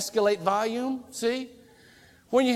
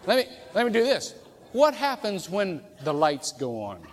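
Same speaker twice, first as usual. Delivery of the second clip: normal talking voice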